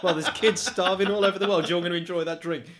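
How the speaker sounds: mock preachy scolding; hammed-up moralizing voice; preachy guilt-tripper.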